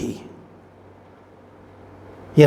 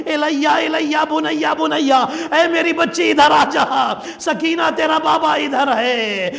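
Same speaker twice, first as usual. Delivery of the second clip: father's voice calling; 12 semitones higher than usual. Acted. Martyred father calls his daughter.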